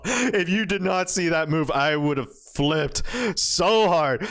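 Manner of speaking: talking through real laughter; relieved laughing. Real, not acted. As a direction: laugh it out, slowing on the last words. Relieved laugh after the awaited move.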